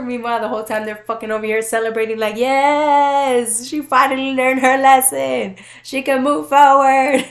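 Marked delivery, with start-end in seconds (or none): 2.4-7.2 loud mock cheer. Guides celebrating her lesson.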